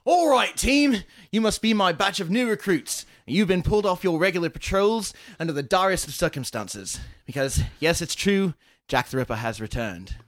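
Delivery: crotchety British inspector rallying his new recruits; Detective Inspector Fox. Shown as a demonstration. Game master briefing new recruits as a Scotland Yard inspector, loud Cockney. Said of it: "just do the voice".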